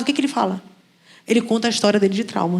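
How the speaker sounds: ordinary talk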